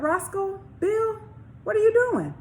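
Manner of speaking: sly bully voice. Acted. Boomer teasing the boys.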